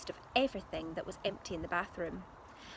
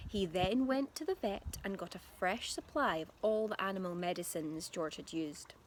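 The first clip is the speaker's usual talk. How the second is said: plain reading voice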